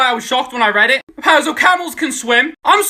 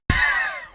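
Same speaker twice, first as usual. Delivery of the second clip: laugh; 7 dB quieter than usual. Real, not acted. Reacting to beluga fact.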